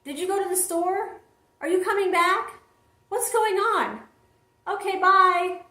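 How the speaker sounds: high, puzzled child's voice imitation; Kennan. Daughter leaving mom a voicemail, imitated high questioning voice. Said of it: "she says".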